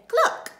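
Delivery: short high, loud cluck; hen cluck. A narrator's reading. Storyteller plays the hen, high clucking. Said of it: "the hen said".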